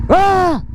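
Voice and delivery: high mimicked yelp; prairie dog cry imitation. Vlogger asking the prairie dog to cry, high imitation. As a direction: demonstrate the animal's high "ah". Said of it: "Can you go".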